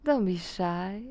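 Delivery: ordinary delivery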